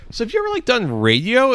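high, hopeful mock bartender asking; mock eager bartender. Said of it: "he wants us to be like".

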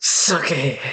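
low, whispery vampire-drama impression; Bill Compton. Testing the accent for co-hosts, Bill Compton impression. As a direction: whisper the name low and drawn out. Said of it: "that's your Bill Compton accent".

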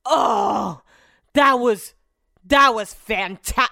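loud, gushing rave about the food; drunk raving. Retold or imitated. Husband raving to the waitress, loud and gushing. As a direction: gush like a drunk fan of the meal.

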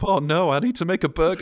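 high, loud waking alarm; panicked cook, hammed-up; mock panic. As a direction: jolt awake in comic panic. Acts waking up panicked by beep.

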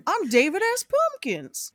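high showy character intro; David S. Pumpkins voice. Retold or imitated. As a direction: announce it high and proud. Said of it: "I looked in the mirror and I went".

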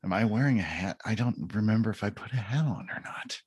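half-whispered puzzled thinking; confused stoned whisper. Retold or imitated. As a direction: whisper it like a lost thought. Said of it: "for a full minute".

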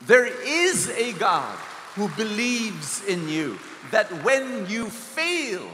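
preacher stressing God's love to the congregation; fervent preaching. Acted. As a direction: build the promise slowly, then let it fall. Preaches about a weeping God.

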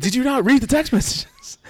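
high, exasperated disbelief; fed up that she never read it. Retold or imitated. Asking his mom about the texts, exasperated and high. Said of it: "I'm like".

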